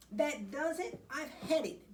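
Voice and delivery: mock-furious storybook snap; fed up. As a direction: lose patience with the cat. Fed up with Kitty's greed.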